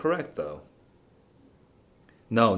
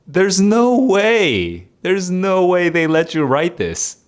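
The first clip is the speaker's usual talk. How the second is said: higher and louder, slow and drawn out; can't believe they allowed this; loud disbelief; 9 semitones higher, 8 dB louder, slower than usual. Real, not acted. Incredulous at the test question.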